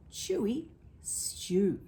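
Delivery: plain reading voice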